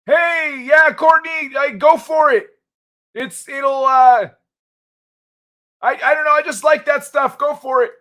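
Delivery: put-on high, loud old man egging her on; old man character. Old man guy urges Courtney to go for it, high voice. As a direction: push it up high and cheer her on. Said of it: "old man guy is, keeps it a hundred".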